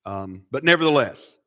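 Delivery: ordinary talk, nothing marked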